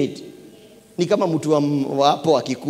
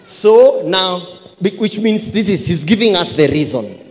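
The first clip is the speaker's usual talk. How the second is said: loud, high scripture proclamation; full-force preaching; 7 semitones higher, 7 dB louder than usual. Real, not acted. Preacher gives church the reason from scripture, full-force preaching. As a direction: proclaim the verse with mounting force.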